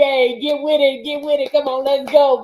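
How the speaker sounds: tough coach. Retold or imitated. Coach imitating herself pushing clients, mock yelling. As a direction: bark it like a drill coach, half-playful. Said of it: "yelling at you".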